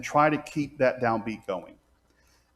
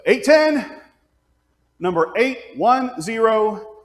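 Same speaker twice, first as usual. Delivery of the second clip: higher, louder call of the number; announcing hymn number; 8 semitones higher, 4 dB louder, slower than usual. Shown as a demonstration. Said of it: "spell out each digit".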